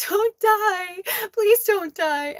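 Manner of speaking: put-on high, pleading little girl voice; scared niece begging; tearful child pleading. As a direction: beg her not to die.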